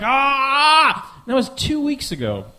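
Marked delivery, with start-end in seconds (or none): 0.0-1.2 a loud pained shout; anguished yell. Retold or imitated. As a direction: let out a loud anguished cry. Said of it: "It was like".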